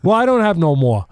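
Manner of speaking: imitated fed-up protest; exasperated retort. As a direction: snap back in a mock squabble. Camille's retort in retold argument.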